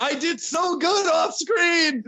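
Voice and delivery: high, loud, drawn-out crying shout; hammed-up sobbing protest; breaking down. Real, not acted. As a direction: wail it in comic despair. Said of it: "Now he's just breaking down".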